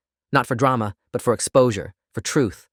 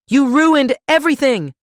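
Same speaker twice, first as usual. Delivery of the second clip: high, loud, drawn-out scream; slow, shrieked outburst of rage; furious scream; 7 dB louder, slower than usual. A narrator's reading. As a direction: scream the accusation at full pitch. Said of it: "Derek screamed it".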